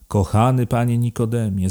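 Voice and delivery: a touch of feigned outrage calling out; mock indignation. A narrator's reading. Kunicki finding Dyzma still working at dawn, mock indignant. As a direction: playfully scold him by name. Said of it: "zawołał z udawanym oburzeniem".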